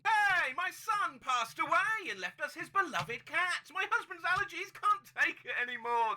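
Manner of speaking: high, projected megaphone announcement. Acted. Reading a post about a free cat, megaphone voice. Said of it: "in Matt Rose's voice with a megaphone".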